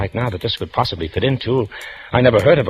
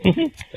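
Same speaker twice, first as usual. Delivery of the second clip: a short, amused burst of laughter; can't hold back a chuckle at her; stifled laugh. Real, not acted. Detective laughs at ransom note.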